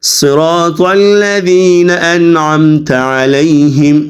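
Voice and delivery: slow, measured recitation with drawn-out vowels; Quran recitation. Shown as a demonstration. Teacher models the verse for students, slow recitation. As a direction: recite slowly and evenly, stretching long vowels.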